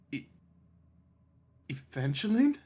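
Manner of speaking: hesitant, shaky call. A narrator's reading. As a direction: call out unsure and trembling. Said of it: "you hear a shaky voice kind of call out".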